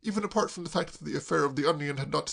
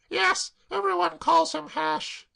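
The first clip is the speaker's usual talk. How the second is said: put-on much higher, slow wretched girl; tearful girl; 4 dB louder, slower than usual. Claire sobbing to Braddock, high tearful wail.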